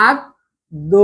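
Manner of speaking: আদ্য broken into "ad... do"; split syllables. Shown as a demonstration. Teacher demonstrating pronunciation, আদ্য split as "ad... do". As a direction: say আদ্য in two low, soft parts ending "o". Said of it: "উচ্চারণ কি হচ্ছে?".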